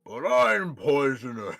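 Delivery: slow, loud frog voice; drawn-out creature voice with POISONOUS stretched; talking frog. Acted. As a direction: protest slowly as a big frog. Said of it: "you hear the frog say back".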